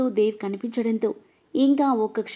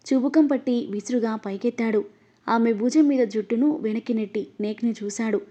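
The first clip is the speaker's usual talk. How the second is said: ordinary delivery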